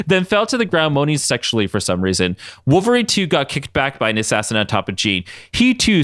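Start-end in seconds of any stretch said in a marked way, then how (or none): none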